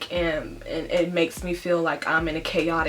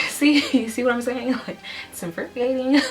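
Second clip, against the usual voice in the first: higher, teary laughing; laughing through tears; 7 semitones higher than usual. Real, not acted. Pregnant vlogger caught welling up, real tearful laughter. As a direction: laugh it off while fighting back tears. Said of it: "I'm trying not to cry".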